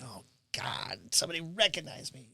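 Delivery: soft, half-whispered groan of embarrassment; embarrassed whisper. Retold or imitated. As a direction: mutter it under your breath, mortified. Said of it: "he's like".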